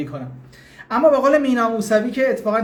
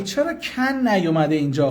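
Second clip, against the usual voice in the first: ordinary talk, nothing marked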